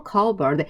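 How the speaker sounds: "커버드" with a silent P; correct cupboard pronunciation. Shown as a demonstration. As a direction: say "커버드" like CUB-erd, no P sound. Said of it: "P 사운드가 발음 나지 않아요". (P 소리 없이 "커버드"로 발음)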